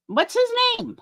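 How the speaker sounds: high, drawn-up singsong question; searching for a name. Real, not acted. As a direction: wonder aloud in a high-pitched voice. Struggling to recall a name.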